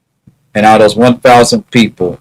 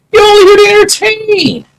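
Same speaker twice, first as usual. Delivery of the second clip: much higher mocking falsetto taunt; faster than usual. Vlogger mimicking critics who say he's only there to entertain, high mocking falsetto. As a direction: squeak it out like a taunting bully.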